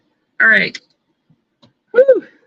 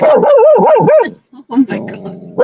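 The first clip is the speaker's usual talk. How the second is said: surprised; 13 semitones higher, 3 dB louder, much slower than usual. Real, not acted. Dog barking, startled exclamation.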